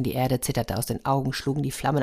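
ordinary delivery, nothing marked

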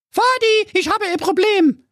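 very high squeaky cry for help; whiny daddy's girl; princess calling daddy. Acted. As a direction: call out to your father in a piping voice. Voices Carola calling her father.

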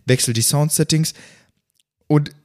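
normal talking voice

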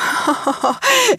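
high bright laugh out loud; burst of laughter. Real, not acted. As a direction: laugh at yourself. Laughs at own confession. (hohes Auflachen über sich selbst)

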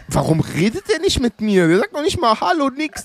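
high, fast, indignant; high-pitched huffy disbelief; indignant thought. Retold or imitated. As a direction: squeak it out, outraged he skips hello. His own indignant thoughts.

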